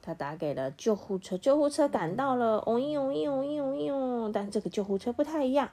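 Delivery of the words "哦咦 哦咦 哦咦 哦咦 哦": high wailing siren sound; siren imitation. A narrator's reading. Storyteller imitating the ambulance arriving, high siren wail.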